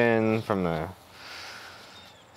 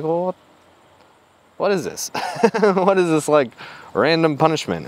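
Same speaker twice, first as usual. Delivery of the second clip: raised, exasperated what is this; incredulous disbelief; 5 semitones higher than usual. Retold or imitated. Pulled off the plane, asking the gate agent, incredulous. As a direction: thrown off and baffled, pushing back.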